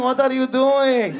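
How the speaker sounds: high, slow, drawn-out Persian impression; flamboyant Persian. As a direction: stretch it out like a showy Persian man. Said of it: "You ever meet a Persian?".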